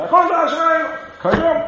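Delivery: high, shouted out with dance fervour; Chassidic shout. Retold or imitated. Imitating Chassidim on Friday night, shouting it out. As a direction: belt it like the whole shul dancing. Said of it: "It's gonna be Tanz Vayichulu".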